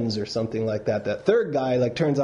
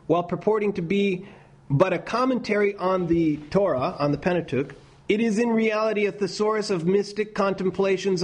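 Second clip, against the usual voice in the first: higher formal reading voice, steady pace; measured quotation reading; 4 semitones higher than usual. Acted. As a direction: read it out like a passage from a book. Reads a scholar's quote aloud.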